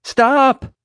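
very high, loud shout; urgent high-pitched cry; desperate yell. A narrator's reading. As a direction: shout to stop someone over the wind. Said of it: "I yelled as loud as I could".

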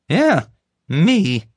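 high, loud, self-satisfied; proud. Acted. Guard taking credit before the group, proudly. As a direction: puff up and claim the credit. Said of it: "Kevin said proudly".

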